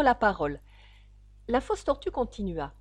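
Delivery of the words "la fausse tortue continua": plain reading voice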